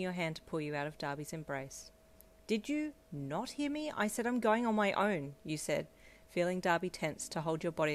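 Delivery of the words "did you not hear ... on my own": high-pitched, exasperated insistence; indignant. A narrator's reading. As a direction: press the point, voice raised in pitch. Repeats refusal to boyfriends ignoring her.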